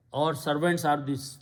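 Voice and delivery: ordinary talk, nothing marked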